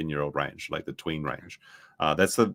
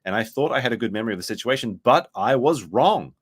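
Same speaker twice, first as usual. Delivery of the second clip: higher-pitched, emphatic reading aloud; statement read-out; 5 semitones higher than usual. Acted. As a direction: read it out like a declaration. Reads a posted statement aloud.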